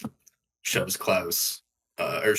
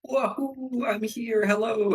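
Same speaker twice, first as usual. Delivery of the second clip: much higher, giddy whoop into hello; gleeful greeting. Acted. Chris hugged by Durnest and Temperina, high giddy greeting. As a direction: cheer like a happy kid arriving.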